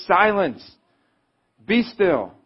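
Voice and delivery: loud, high commanding rebuke; Jesus rebuking the wind and waves, a loud command. A narrator's reading. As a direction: order the storm to stop. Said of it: "he rebuked the wind and said".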